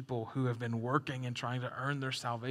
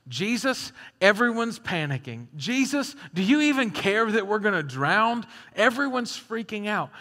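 louder, higher, frantic cry to a sleeping Jesus; panicked plea; 5 semitones higher, 8 dB louder than usual. Acted. Disciples crying out to Jesus in the storm, frantic and loud. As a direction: shout it out like men about to drown.

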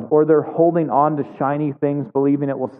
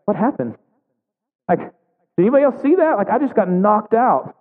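higher-pitched, mock-bewildered reaction; dazed disbelief; 7 semitones higher than usual. Preacher voicing someone knocked down by life, dazed disbelief. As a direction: act out someone stunned after being knocked over. Dazed victim acted out in sermon.

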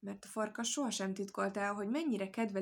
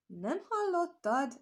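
slow, high mock astonishment, a bit louder; astonished; 9 semitones higher, 4 dB louder, much slower than usual. A narrator's reading. The hare feigning astonishment to fool the wolf, slow and high. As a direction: draw out the fake amazement, rising high. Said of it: "álmélkodott a nyúl".

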